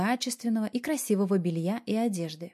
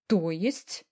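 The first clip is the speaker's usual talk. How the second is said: taken aback; 2 semitones lower than usual. A narrator's reading. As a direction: ask it mildly stunned. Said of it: "опешила я". (слегка опешив)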